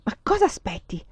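a touch of impatience; mildly exasperated, pressing question; impatient. A narrator's reading. Vasily prods his hesitating wife, mildly impatient. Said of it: "Vasily si impazientiva".

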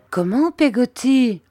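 high, loud, drawn-out lament; slow, plaintive cry of dismay. A narrator's reading. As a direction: wail the question mournfully. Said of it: "dis-je d'un ton lamentable".

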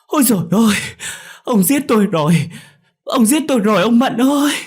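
very high, loud wailing scream; agonised mother crying out at full force; screaming in pain. A narrator's reading. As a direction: shriek as if being killed. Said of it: "ôm đầu mà gào thét".

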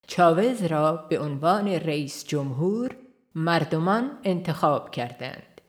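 ordinary delivery

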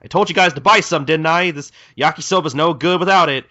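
imitated irritable rant; bitching back. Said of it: "starts bitching at him right back".

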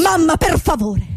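high, desperate cry for mother; panicked plea. Acted. Reporter waking in panic, crying for her mother. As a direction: beg in terror.

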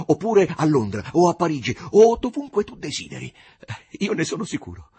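loud, high, exuberant offer; cheerful. A narrator's reading. Martin offers Ruth any city she wishes, cheerful. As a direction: sweep upward merrily through the grand list. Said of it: "con allegria".

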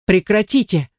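acted startled outcry; crying out. A narrator's reading. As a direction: cry out to stop them, without full volume. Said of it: "вскрикнула я".

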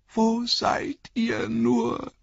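high, slow wailing call; plaintive call. Acted. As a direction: call out miserably. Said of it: "rief er jämmerlich".

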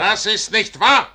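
loud, high angry shout; yelled it is not true; furious denial. Acted. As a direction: snap back a flat refusal.

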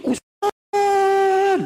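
high, loud call across the yard. Retold or imitated. Mother calling the ustaz from outside, loud high call. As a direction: shout his title to get his attention.

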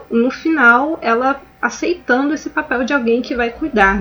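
normal talking voice

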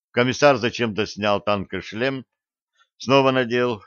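ordinary delivery, nothing marked

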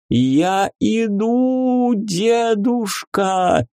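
high, slow squeal of joy; squealing slime creature. A narrator's reading. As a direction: squeal it out like a tiny blob. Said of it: "завопило зелёное существо".

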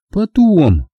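high, falling dismissal; Angela's voice. A narrator's reading. Angela putting off his proposal, high voice. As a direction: put him off in a high character voice.

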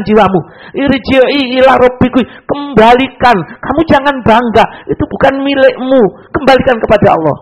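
the voice of God gently scolding a proud preacher; divine admonishing voice. Retold or imitated. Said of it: "ada suara yang mengingatkan".

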